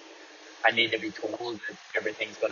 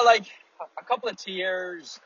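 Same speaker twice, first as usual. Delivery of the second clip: ordinary talk, nothing marked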